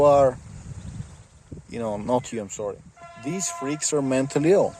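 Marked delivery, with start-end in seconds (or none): none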